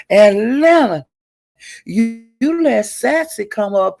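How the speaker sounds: high-pitched mock rant, defiant; mimicking Angela's rant. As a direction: raise the pitch and mimic her complaining. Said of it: "And she was saying".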